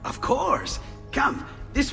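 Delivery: high-pitched, eager welcome; eager agreement. Acted. Inventor asked to repair something, eager agreement. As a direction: usher him in excitedly.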